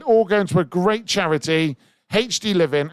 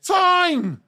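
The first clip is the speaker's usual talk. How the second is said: excited call; 11 semitones higher, much slower than usual. Real, not acted. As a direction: announce the end with a burst. Calling end of the auction.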